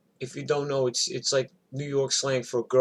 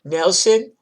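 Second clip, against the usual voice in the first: loud, higher summons; calling husband; 6 semitones higher, 9 dB louder than usual. Retold or imitated. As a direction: imitate a wife calling out to her husband. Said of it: "She goes".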